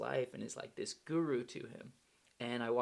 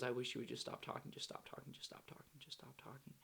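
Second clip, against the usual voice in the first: quick repeated plea fading to near-whisper; retold weary plea; 9 dB quieter than usual. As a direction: imitate mom's plea, trailing away softer each time.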